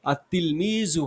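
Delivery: "আত-তিলমিজু" slowly, with a doubled t and no lam; drawn-out model of "আত-তিলমিজু" for students; teacher slowly sounding out "আত-তিলমিজু" without the l; careful Arabic pronunciation. Shown as a demonstration. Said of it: "পড়ার সময়তে কিন্তু আসবে না এই লামটা".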